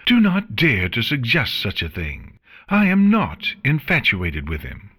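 usual speaking voice

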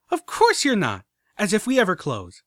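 high, loud, bubbly glee; exuberant filly. A narrator's reading. Filly laughs off the joke, bright high glee. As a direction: bounce through it with glee. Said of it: "She giggled exuberantly".

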